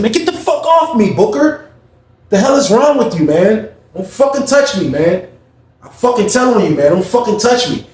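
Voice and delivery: high, indignant retold outburst; angry protest. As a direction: act out fending off a bully.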